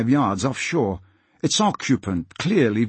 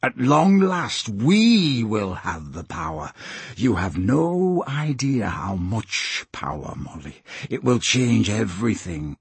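gloating villain. Acted. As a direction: draw out the words, relishing the power.

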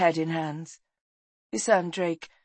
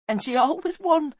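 high, raised voice on the edge of weeping; grieving young voice, pitched up; boy near tears; 11 semitones higher, 5 dB louder than usual. A narrator's reading. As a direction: push it high and loud, about to cry. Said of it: "Then he began to weep".

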